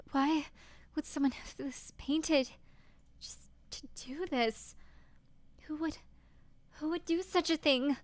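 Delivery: put-on a girl finding her slashed portrait, rising panic; frightened heroine. Streamer voicing the heroine discovering the painting, frightened.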